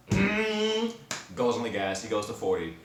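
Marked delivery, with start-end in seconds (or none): 0.1-0.9 high, loud humming rev; engine revving imitation. Storyteller as Sterling speeds up, high revving noise. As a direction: hum like a car hitting the gas.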